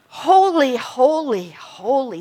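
first holy high and loud, then settling; proclaimed like a seraph who can't stop; awed, emphatic. Shown as a demonstration. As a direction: cry out holy in overwhelming wonder. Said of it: "they can't stop saying".

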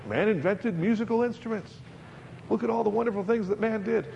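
high, gushing mock praise of man; sarcastic voice of the world praising man; mock admiration. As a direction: lay on fake awe for mankind. Mocking the world's boast.

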